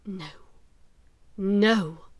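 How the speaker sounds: ordinary delivery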